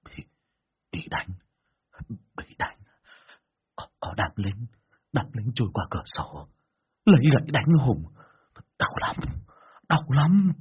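halting, stammered groans of pain; writhing patient's faltering pained voice; moaning in pain. A narrator's reading. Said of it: "nằm lăn lộn ở trên giường rên hừ hừ".

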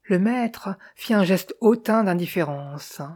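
usual speaking voice

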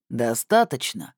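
slow, pressing question; deliberate, low-voiced interrogator; menacing inquiry. A narrator's reading. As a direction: probe the prisoner, taking your time. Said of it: "поинтересовался человек".

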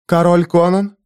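usual speaking voice

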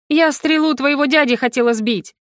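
high-pitched, irritated protest; angry growl. A narrator's reading. Heroine explaining her shot, angry growl. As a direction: growl it in annoyance. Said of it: "рыкнула я".

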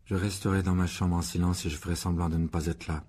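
low, toneless recited reply; gloomy. A narrator's reading. Boy repeating his part to his uncle, gloomy. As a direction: recite it glumly without inflection. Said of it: "répondit sombrement Harry".

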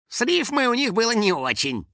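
high-pitched dry aside; sarcastic. A narrator's reading. House spirit judging the ancient rhyme, sarcastic. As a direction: add it as a sarcastic little comment. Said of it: "с сарказмом добавил".

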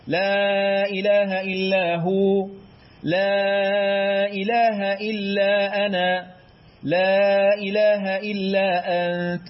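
لا stretched long, slow chanted; slow chanted recitation, مد on لا; drawn-out la for glorification. Shown as a demonstration. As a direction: hold the لا in لا إله long and melodic. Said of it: "يبقى مد التعظيم هتقرأ كده".

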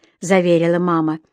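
plain reading voice

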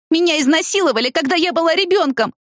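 hammed-up wailing to shock the old women; staged wail. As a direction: fake a howl of distress. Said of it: "внезапно завопила она".

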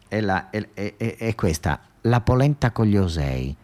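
ordinary talk

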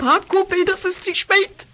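desperate outburst to her sister; desperate cry. Acted. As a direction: cry out that it's too late. Desperate plea.

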